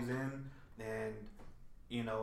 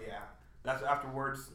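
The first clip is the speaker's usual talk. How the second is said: usual speaking voice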